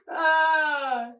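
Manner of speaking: usual speaking voice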